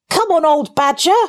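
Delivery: high call, no real volume; shouted invitation. A narrator's reading. Rat calling badger over to join them, a mild high shout. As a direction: call out high, as if to someone distant. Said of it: "shouted the rat".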